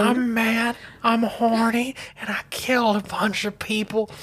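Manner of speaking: high-pitched whining confession, hammed up; mock mad whine. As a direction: wail it like a comic tantrum. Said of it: "And he's like, "I'm mad".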